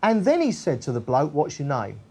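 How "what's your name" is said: softer, lower, casual question; personal touch. Retold or imitated. Jesus asks the bloke his name, soft and personal. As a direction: ask it gently, person to person. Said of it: "Personal touch".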